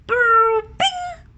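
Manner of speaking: high loud brrr and bing; comic sound effect. Acted. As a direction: make a cartoon bonk noise.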